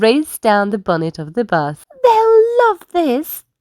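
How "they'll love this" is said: high, slow, pleased little voice; picture the children's joy; bus JJ's voice. A narrator's reading. Bus JJ imagining children on the slide, high and pleased. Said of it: "thought J J".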